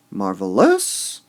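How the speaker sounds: Marvelous slow, MAR leaned on, rising; stress on MAR. Shown as a demonstration. Teacher repeats Marvelous showing the mar stress. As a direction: lean on MAR in Marvelous and rise. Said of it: "We emphasize the mar part".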